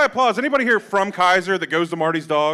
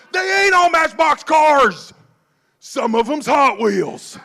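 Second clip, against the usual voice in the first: indignant customer; 10 semitones higher, 6 dB louder, slower than usual. Retold or imitated. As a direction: drag it out like he's proud of the junk. Customer voiced, insisting.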